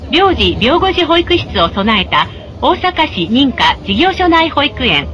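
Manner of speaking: usual speaking voice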